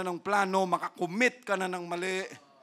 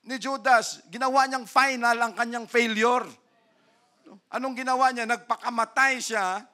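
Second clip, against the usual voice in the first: loud, high, driving sermon voice; fiery preaching; 6 semitones higher, 8 dB louder than usual. Acted. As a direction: preach it hard at the congregation. Preaching at full force.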